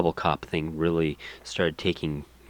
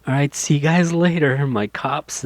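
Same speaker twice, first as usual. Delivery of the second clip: mock YouTuber outro; 7 semitones higher than usual. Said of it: "it still ended with".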